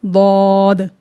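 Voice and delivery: दौद held long, thick and full; tongue edge on upper molars for दौद; heavy dod. Shown as a demonstration. Teacher demonstrates the tongue placement, long heavy दौद. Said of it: "तब ये हर्फ अदा होता है".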